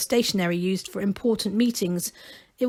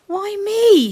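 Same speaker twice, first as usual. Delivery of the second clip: dismayed; 12 semitones higher, 7 dB louder than usual. A narrator's reading. Gerda protesting.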